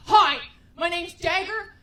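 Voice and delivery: loud tough-guy boasting; intense contestant. Retold or imitated. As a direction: boast loudly like a macho contestant. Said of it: "every contestant on Chopped is like".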